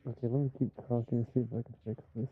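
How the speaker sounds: muffled, fuzzy mic sound; muffled distorted mic. Real, not acted. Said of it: "I never sounded this muffled before".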